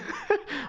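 high sobbing laugh; badly faked weeping; pretend crying. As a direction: pass off giggles as sobs. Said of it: "pretend to cry".

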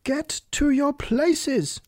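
high, very drawn-out command; thunderous shout. A narrator's reading. Queen starts the game, high long shout. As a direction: call everyone to order, stretching each word. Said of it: "shouted the Queen in a voice of thunder".

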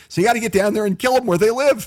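loud, high fighting call; fired-up call. Real, not acted. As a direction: rouse them to battle. Heated political argument.

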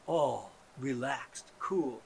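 mock mellow murmur; soft calm. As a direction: ease right down. Drops to low soft calm.

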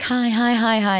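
eager extras greeting. Retold or imitated. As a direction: imitate fans chirping hello. Voicing a crowd of eager extras.

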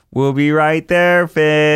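dad imitating his toddler on the baby monitor. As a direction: announce it like a little boy on a walkie. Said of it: "presses the talk button and says".

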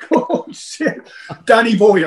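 breathless laughing exclamation. Real, not acted. Laughing at WhatsApp group memories.

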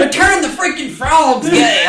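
loud, high-pitched conspiracy rant; Alex Jones impression. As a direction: holler it like an outraged conspiracy host. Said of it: "I'm going full Alex Jones".

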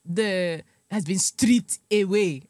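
put-on slow, measured Wakandan accent; stately put-on Wakandan accent; mock Wakandan accent. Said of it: "Wakanda, I let the accent slide".

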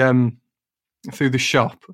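ordinary talk

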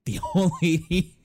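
real laughter breaking in; laughing. Real, not acted. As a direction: giggle it out in disbelief. Laughing while retelling.

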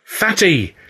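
high shout of shock. A narrator's reading. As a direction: cry out the name in alarm. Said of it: "and shouted out".

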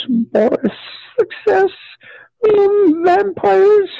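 put-on very high, slow child voice; high-pitched, morbid little patient; young Chris. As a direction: list them haltingly in a squeaky high pitch.

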